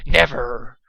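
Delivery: loud refusal. A narrator's reading. Dusk refuses brunch.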